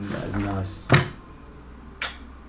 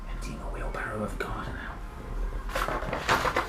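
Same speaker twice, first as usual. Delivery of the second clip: playful whisper; 3 semitones lower, 9 dB quieter, slower than usual. Pretend. Hero undercover in picture.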